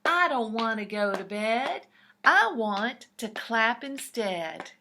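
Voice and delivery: loud, grumpy protest; grumpy toad. Acted. Grumpy Toad protests loudly that he won't go to bed. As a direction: whine it like a grumpy kid. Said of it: "said Grumpy Toad".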